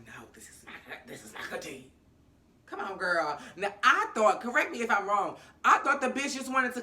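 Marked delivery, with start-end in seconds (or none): 0.1-1.9 much softer, lower, tutting it off; hushed, unimpressed aside; quiet disapproval. Retold or imitated. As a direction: mutter it under your breath like bad news. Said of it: "But Issa was like".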